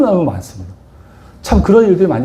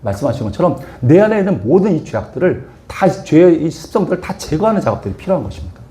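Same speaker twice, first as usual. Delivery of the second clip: normal talking voice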